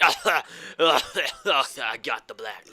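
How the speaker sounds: sag into a coughing machine; wheezing sick robot. Pretend. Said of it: "I was like".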